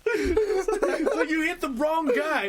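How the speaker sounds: high, helpless laughter through the words; cracking up. Real, not acted. Host recalling a favorite movie scene, laughing helplessly. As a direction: laugh so hard the voice squeaks.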